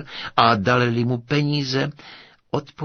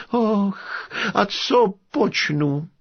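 high, slow wail of helplessness; despairing lament; 10 semitones higher, slower than usual. A narrator's reading. Prince at a loss after his daughters refuse, despairing.

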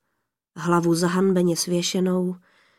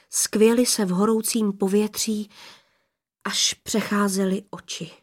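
ordinary delivery, nothing marked

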